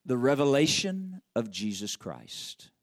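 preacher reading scripture slowly. Shown as a demonstration. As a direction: read slowly and deliberately. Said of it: "And I pause right there".

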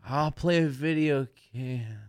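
high, drawn-out musing to oneself; acted-out idle thought in a high, slow voice. Acting out bored musings at home.

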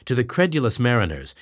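plain reading voice

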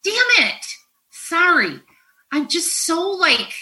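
high, anguished outpouring; real distress breaking into a curse; emotional. Real, not acted. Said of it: "I'm gonna get emotional here".

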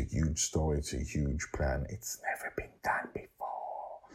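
fading into a secret whisper. Real, not acted. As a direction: let it sink to a hush. Secret teaser, dropping to whisper.

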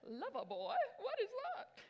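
badly faked British accent, pitch leaping up high; mock-puzzled bandmate in a hammy British accent. Preacher imitating bandmate baffled by Loverboy, bad British accent. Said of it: "that was a really bad British accent".

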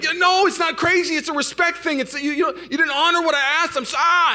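very high, worked-up rant; retold passionate yelling. Husband told by wife he's acting crazy, acted yelling rant. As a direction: sputter and yell, pitch climbing far up. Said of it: "we're just yelling and talking very passionately".

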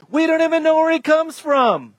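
high, scornful dismissal; acted indignant contempt; hurled insult. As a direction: dismiss him with pitch jumping up. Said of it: "hurled insults at him".

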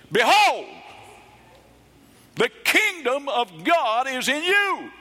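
high, booming proclamation; loud, high-pitched exclamation, stretched out slowly; Jesus's emphatic shout. Acted. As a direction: shout it out to grab everyone's attention. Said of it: "it would be like this. Jesus would say".

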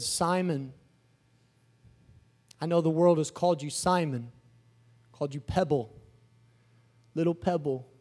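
low, soft, unhurried address; calm Jesus. Acted. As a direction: speak gently and intimately to one disciple. Plays Jesus speaking to Simon.

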